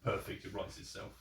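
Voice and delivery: normal talking voice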